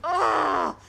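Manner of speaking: loud exasperated "ugh"; frustrated groan. Acted. Filmmaker groaning at the ruined shoot, exasperated. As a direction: let the frustration out.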